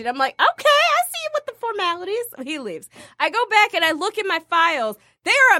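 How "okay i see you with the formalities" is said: high, quick mock-impressed tease; playful teasing. Teasing Orrin for saying Miss Stacy, playfully impressed. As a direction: tease him in a raised pitch. Retold teasing of his formal address.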